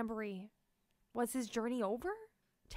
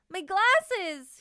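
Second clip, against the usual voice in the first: high, loud dismayed cry; 8 semitones higher, 9 dB louder than usual. Acted. Tank losing his glasses, high loud dismayed cry. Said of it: "he cried out".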